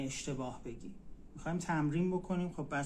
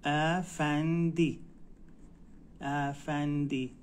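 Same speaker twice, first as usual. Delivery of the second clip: افندی drawn out very slowly; slow teacher model; slow demo; much slower than usual. Shown as a demonstration. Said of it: "هانم".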